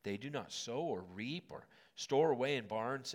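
ordinary talk, nothing marked

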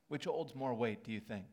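ordinary delivery

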